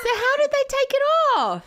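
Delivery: loud, high incredulous question; high-pitched outcry; aghast disbelief. Real, not acted. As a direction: demand it, shocked and amused. Appalled, asks about the prank.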